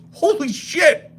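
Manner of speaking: high, wide-eyed holy shit; sudden astonished squeal; amazed gasp. Retold or imitated. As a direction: blurt it out like a stunned coworker. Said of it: "somebody goes".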